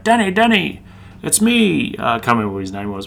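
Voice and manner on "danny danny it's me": much higher, put-on old man calling out; mock old man hailing Danny across the room; old Chinese man voice. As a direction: impersonate the old mate greeting him eagerly. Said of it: "this old, um, Chinese dude's like".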